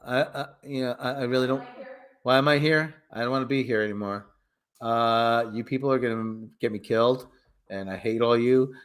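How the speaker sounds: low, monotone mumbled complaint; reluctant prince, low and droning; Flash Thompson. Acted. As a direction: grumble along in a low, soft monotone. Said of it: "Flash Thompson's like".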